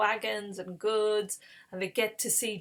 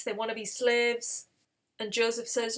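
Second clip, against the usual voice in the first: ordinary delivery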